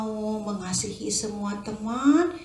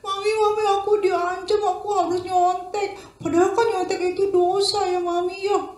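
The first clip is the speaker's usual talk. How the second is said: put-on high, pleading little boy; frightened child; 10 semitones higher, 3 dB louder than usual. Boy telling his mother he's threatened, high and pleading. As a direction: complain that he's being threatened. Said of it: "diancam-ancam rupanya Dino ini".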